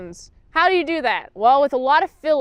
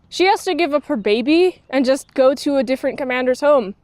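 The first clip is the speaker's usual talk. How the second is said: usual speaking voice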